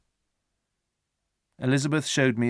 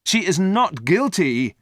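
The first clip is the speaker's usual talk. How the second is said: slow, loud, higher protest; cried out; 8 semitones higher, 5 dB louder, slower than usual. A narrator's reading. Victor crying out to his father, slow and loud. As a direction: insist she is innocent. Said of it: "I cried".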